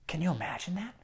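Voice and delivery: awed whisper to the listeners; whispered question. Real, not acted. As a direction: lean in and whisper it. Storyteller asks the children.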